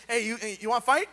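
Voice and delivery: quick, offhand street pitch to strangers; casual recruiter. Retold or imitated. Gideon sizing up random dudes, quick casual call. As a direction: toss it at a passerby like a dare. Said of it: "just asking random dudes on the street".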